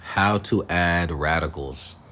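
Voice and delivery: usual speaking voice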